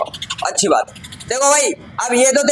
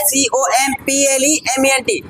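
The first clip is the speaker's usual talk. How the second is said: slow letter-by-letter spelling; spelled out; much slower than usual. Shown as a demonstration. Teacher contrasting the second homophone's spelling for students, slowly spelled out. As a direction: give each letter its own beat. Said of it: "सिर्फ आई का अंतर है इसमें".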